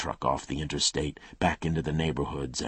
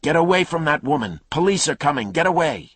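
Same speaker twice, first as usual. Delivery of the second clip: loud, higher shouted warning; man yelling; 13 semitones higher, 10 dB louder, faster than usual. Acted. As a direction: bellow at him to get away. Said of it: "Man's voice".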